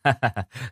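pleased, self-satisfied chuckle; short happy laughing; gleeful laugh. A narrator's reading. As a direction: laugh with delight. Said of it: "Tín vừa cười khoái chí lắm".

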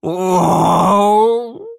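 loud, rising wail of despair; anguished howl. A narrator's reading. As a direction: howl into your palms. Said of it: "взвыл Шабельский".

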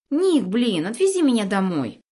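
put-on high loud whiny boy. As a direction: plead like a scared spoiled brat. Scared kid pleads to go home.

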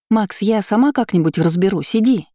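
put-on girl's voice. A narrator's reading. Nastya, female voice, high.

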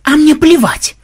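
very high, loud defiant yell; sudden yell. A narrator's reading. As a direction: explode in defiance. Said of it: "вдруг заорал Ксенобайт". (вдруг заорать, с вызовом)

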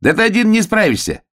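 very high hare voice; high-pitched excitable hare; protesting hare. A narrator's reading. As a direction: squeal the objection up high. Hare doubts the bear.

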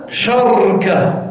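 شركة said slowly as sharka, open sh and silent r; sharka pronunciation. Shown as a demonstration. Teacher showing students the sharka form, slowly spelled out. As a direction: drag out شركة as sharka with no vowel after the r. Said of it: "ويجوز أن تقول".

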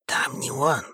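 soft barely audible reply; hushed whisper. A narrator's reading. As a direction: say it almost without sound. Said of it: "также неслышно ответил Андрей". (неслышно, шёпотом)

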